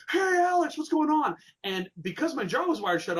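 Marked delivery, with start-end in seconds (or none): 0.1-1.4 high, loud friendly call across the room; loud greeting. Retold or imitated. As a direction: call out a cheery hello from far off. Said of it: "he was like".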